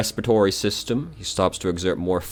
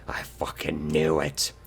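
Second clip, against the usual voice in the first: whispering; 4 dB quieter than usual. Real, not acted. Whispered aside at the table.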